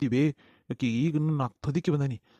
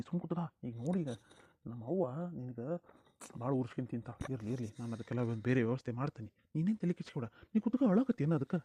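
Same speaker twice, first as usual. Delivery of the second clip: hushed reassurance; 6 dB quieter than usual. Acted. Husband secretly reassures wife.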